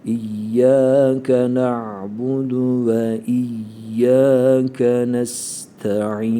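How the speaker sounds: slow drawn-out Quranic chant; slow recitation. Shown as a demonstration. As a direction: chant it slowly, every sound stretched.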